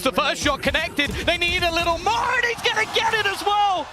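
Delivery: building excitement. Real, not acted. Caster calling a clutch attempt.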